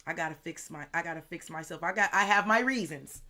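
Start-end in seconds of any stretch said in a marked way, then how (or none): 1.8-3.2 loud outburst with pitch climbing; loud self-defence. Real, not acted. Reader defends being bad at receiving help, loud outburst. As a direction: burst out defensively at full volume.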